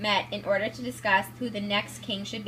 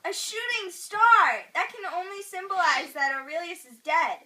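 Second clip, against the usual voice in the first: amazed omen; 8 semitones higher than usual. Acted. Seer sees omen.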